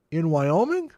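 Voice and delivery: surprised. Real, not acted. Reacting to a surprising email.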